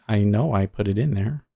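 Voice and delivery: ordinary talk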